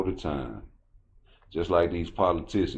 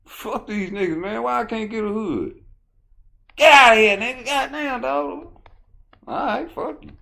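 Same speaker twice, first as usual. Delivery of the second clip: high, fast mock voice; wannabe at a rally; 14 semitones higher, 6 dB louder than usual. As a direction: play a man begging to fit in. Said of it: "Trying to fit in".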